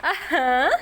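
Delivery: usual speaking voice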